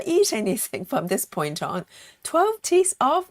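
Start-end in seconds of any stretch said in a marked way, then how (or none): 2.2-3.3 shocked. Real, not acted. Shocked at losing twelve teeth.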